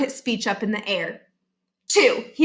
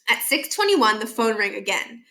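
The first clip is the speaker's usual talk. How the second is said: ordinary delivery, nothing marked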